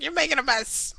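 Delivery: high, whiny mock complaint; imitating the girlfriend. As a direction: whine it like the annoyed girlfriend. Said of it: "She goes, like".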